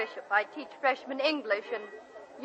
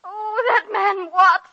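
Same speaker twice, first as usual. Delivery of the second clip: exasperated; 7 semitones higher, 8 dB louder, much slower than usual. Acted. Upset teacher complains to old friend.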